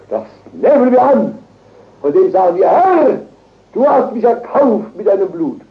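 high, loud, emphatic cry; fervent proclamation. Real, not acted. Preacher leads the faithful in claiming the ransom, fervent and loud. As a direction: seize on it with full conviction.

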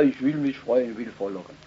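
quiet. Acted. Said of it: "Und da sagt er".

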